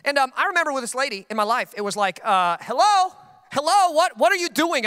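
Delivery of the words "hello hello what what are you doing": much higher, louder mock "hello, anyone home"; mock calling out. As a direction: call out in exasperated disbelief. Retold calling her out.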